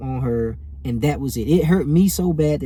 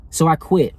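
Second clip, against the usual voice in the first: ordinary talk, nothing marked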